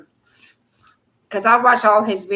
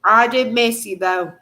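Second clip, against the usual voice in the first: normal talking voice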